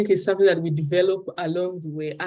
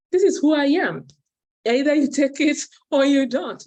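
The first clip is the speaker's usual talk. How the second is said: higher and louder, chin up, owning it; defiant declaration; 8 semitones higher, 6 dB louder than usual. Retold or imitated. Telling herself after her breakthrough, a loud defiant declaration. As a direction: announce it to the world, take me or leave me. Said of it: "I said to myself".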